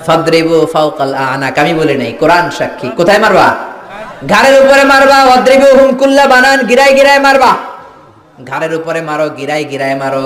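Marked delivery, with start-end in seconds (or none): 4.3-7.5 high, loud sermon cry; loud preacher's call; fiery preaching shout. Acted. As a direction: thunder the command at the crowd. Preacher hammers a Quran command.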